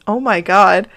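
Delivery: plain reading voice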